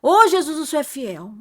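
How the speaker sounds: loud, high burst of praise; joyful praise. Retold or imitated. The man revives, preacher bursts into loud joyful praise. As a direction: shout thanks to heaven. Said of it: "Eu falei desse jeito".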